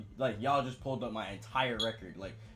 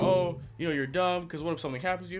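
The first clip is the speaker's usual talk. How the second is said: yelling; 8 semitones higher, 5 dB louder than usual. Retold or imitated. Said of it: "Now she's yelling at me like".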